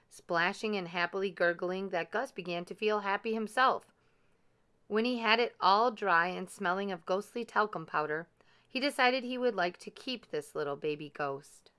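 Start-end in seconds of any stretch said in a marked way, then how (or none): none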